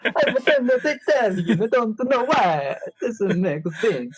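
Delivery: high, slow, hammed-up Italian accent; mock Italian accent. Host claiming to be from Italian, mock Italian accent. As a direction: draw out a silly fake Italian accent. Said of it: "What is Italian?".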